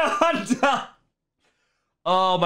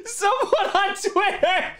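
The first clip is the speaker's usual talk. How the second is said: high-pitched, tearful giggly telling; helpless laughter; 7 semitones higher than usual. Real, not acted. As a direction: tell it while cracking up. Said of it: "that's so funny".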